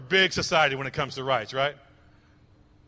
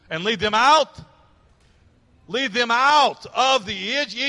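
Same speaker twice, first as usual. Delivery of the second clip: slow, raised preacher's declamation; emphatic scripture reading; 5 semitones higher, 7 dB louder, slower than usual. Acted. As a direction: proclaim the verse, stretching key phrases. Preacher declaims scripture with audience response.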